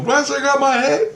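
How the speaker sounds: high-pitched re-enacted astonishment; amazed relief. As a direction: act out finding your head still on. Acts out amazed relief after battle.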